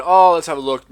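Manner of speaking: high, loud sing-song oh; mocking doctor. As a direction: parody a cheery doctor taking a look. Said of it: "and they said".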